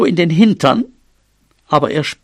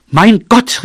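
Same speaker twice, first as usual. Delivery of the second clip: higher, louder exasperated cry; exclaiming; 5 semitones higher, 5 dB louder than usual. Acted. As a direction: cry out in indignation. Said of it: "rief sie". (empört ausgerufen)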